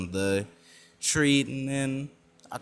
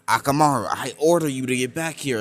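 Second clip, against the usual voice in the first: commanding shout; 3 semitones higher, 6 dB louder than usual. Acted. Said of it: "And he's like".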